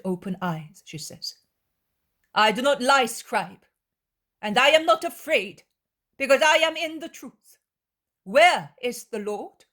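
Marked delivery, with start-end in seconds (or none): 2.3-9.6 loud, high defiant answer. Acted. Young woman defying the scribe, loud and firm. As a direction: stand firm and fling it back.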